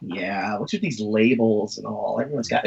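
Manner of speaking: low, drooping cartoonist grumble; Robert Crumb. Host imitating his son as the cartoonist, low grumbling. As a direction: mumble it deep and offhand. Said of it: "do a great Robert Crumb impression".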